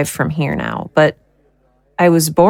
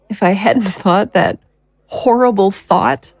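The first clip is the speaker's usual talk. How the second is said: ordinary delivery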